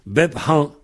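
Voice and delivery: "Bep han" with an aspirated H; H prononcé. Shown as a demonstration. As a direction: keep the breath on "han" after "Bep". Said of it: "C'est comme ça qu'on dit chez moi". (« Bep han » avec le H soufflé)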